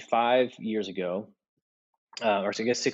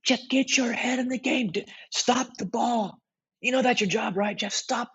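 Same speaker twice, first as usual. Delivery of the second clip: high, yelling berating coach; shrill-free high-pitched frantic scolding from the sideline; coach losing his mind; slower than usual. Retold or imitated. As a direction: scold the goalie like a coach who's lost it. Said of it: "his coach is just losing his mind".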